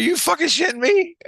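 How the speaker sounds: high, astonished outburst; shocked disbelief. Retold or imitated. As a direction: blurt it out like you can't believe your ears. Retelling his shocked reply to buddy.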